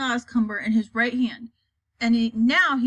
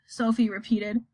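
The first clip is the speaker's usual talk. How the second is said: plain reading voice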